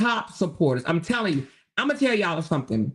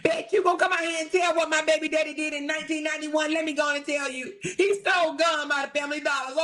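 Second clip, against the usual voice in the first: high mocking sing-song voice; mocking taunt; 6 semitones higher than usual. Mocking the rival who told her own secrets, taunting. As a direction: mimic her smugly, dripping sarcasm.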